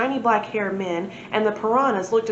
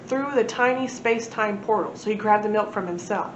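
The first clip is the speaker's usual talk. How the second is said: ordinary delivery, nothing marked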